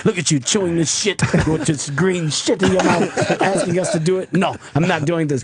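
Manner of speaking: imitated African accent, disgusted scolding; African-accented mock indignation. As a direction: imitate your African friend telling him off. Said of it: "he's got this African accent".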